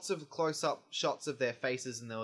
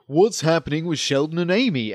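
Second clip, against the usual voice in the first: TV ad voice; 3 semitones higher, 14 dB louder than usual. Retold or imitated. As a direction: hype it like a TV promo. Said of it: "you'll see an ad that's like".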